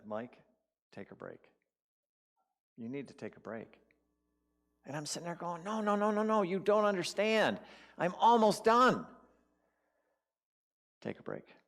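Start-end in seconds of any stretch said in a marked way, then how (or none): 0.1-3.8 soft, quiet voice from within; calm inner voice. Retold or imitated. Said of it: "I hear this voice". 5.6-9.1 high, pleading refusal; acting out his own protest, pitched up high; frustrated protest. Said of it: "In all my frustration and all my confusion". 11.0-11.6 low, soft murmur of an inner voice; quietly insist, deep and unhurried; calm inner voice. Retold or imitated. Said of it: "I hear this voice".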